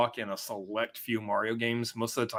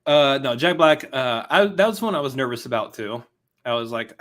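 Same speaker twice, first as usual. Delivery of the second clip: usual speaking voice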